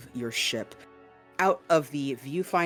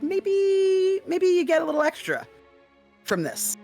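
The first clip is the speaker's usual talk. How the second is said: much higher, louder inner musing; 4 dB louder than usual. Acted. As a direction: squeak out the greedy thought. Inner voice of player.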